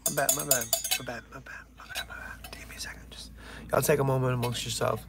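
fading to a hammed-up choked whisper; mock verklempt. Mock-choked apology to viewers, fading to a whisper. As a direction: pretend to fight back tears. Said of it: "I'm getting very verklempt".

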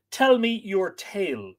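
slow, cold demand; icy, deliberate request. A narrator's reading. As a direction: say it coldly and slowly. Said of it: "she said coldly".

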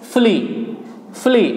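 fully with one short l, "FU-lee"; correct pronunciation. Shown as a demonstration. Teacher confirming the right form, correct pronunciation fully with single l. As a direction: say fully falling, without doubling the l. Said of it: "correct pronunciation".

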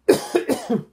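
sharp loud cough. Real, not acted. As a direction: cough hard. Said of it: "Sorry about the coughing".

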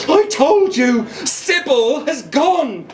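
high, slow, pleading insistence; insistent boy. A narrator's reading. Boy insisting to his mum: slow high plea. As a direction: drag out gone in despair.

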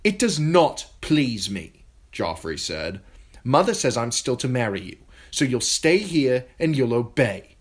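put-on boy king dictating to his betrothed; cold royal contempt. A narrator's reading. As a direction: lay down the law cruelly. Joffrey to Sansa.

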